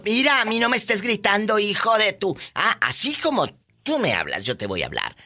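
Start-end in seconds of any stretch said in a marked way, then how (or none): 0.0-2.4 high, firm put-down to a yelling man; mock scolding. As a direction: tell him off, half joking. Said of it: "Mándalo".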